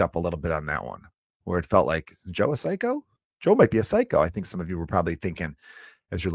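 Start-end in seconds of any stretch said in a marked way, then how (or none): 2.1-4.2 high, wary inner voice; mock suspicious listener. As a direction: voice the listener wondering if he's a psycho. Imagined listener's suspicious thought.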